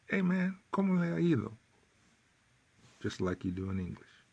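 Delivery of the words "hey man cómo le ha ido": much higher, loose and breezy; "Hey man, ¿cómo le ha ido?" thrown off casually; casual street greeting. Shown as a demonstration. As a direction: toss it off like greeting a buddy. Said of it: "They're gonna say".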